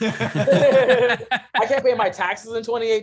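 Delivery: loud, high, incredulous complaint through laughter; comic exasperation. Real, not acted. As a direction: protest loudly to the crowd, playing it for laughs. Joking exasperation, audience laughing along.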